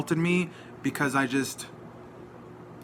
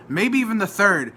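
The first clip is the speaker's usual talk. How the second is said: emphatic rise; 6 semitones higher, 5 dB louder than usual. Real, not acted. Building rant about self-defense.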